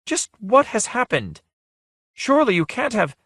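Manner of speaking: puzzled plea, voice lifted; confused. Acted. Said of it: "turned in confusion".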